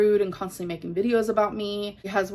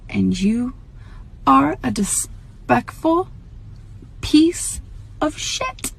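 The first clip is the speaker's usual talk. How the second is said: angry insult; 3 semitones higher, 4 dB louder, slower than usual. Real, not acted. Vlogger calls out a rude commenter, rising in anger. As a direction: spit the insult at the trolls.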